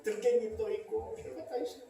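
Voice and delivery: ordinary talk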